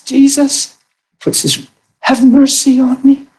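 high pleading plea for mercy; pleading leper. Retold or imitated. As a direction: beg for mercy like a desperate outcast. Leper begging Jesus, voiced.

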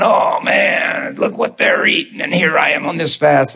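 half-hearted mock envy of people eating. Mocking a grumbling faster.

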